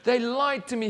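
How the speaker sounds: blaming. Acted. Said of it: "he blamed".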